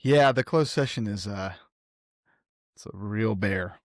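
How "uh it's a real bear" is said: ordinary delivery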